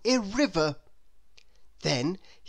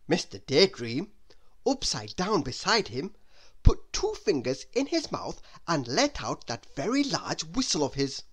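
ordinary delivery

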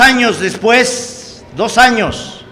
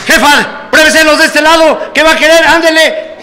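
raised, lively sales patter; vendor's call; 5 semitones higher than usual. Retold or imitated. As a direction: hawk the shoes to a passing lady. Street vendor calling to customers.

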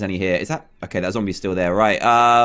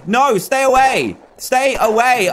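panicked pleading; 9 semitones higher, 4 dB louder, slower than usual. Real, not acted. Zombies attacking him.

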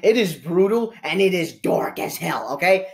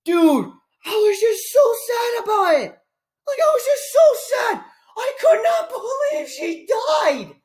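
hammed-up sobbing, much higher; the reviewer fakes tears over Aunt May, mock sobbing; fake crying. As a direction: whine it like a mock sob. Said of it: "So I tried crying on command".

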